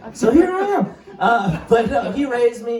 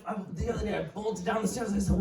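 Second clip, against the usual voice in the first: normal talking voice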